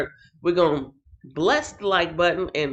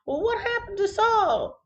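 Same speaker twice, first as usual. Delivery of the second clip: high dramatic question; 13 semitones higher than usual. Real, not acted.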